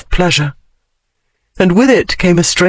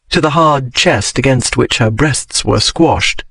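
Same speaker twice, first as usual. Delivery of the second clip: usual speaking voice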